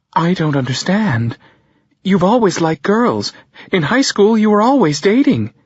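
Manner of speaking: confused, rising disbelief; bewildered mother. Acted. Mother struggling with her son's news, bewildered. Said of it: "my mother says".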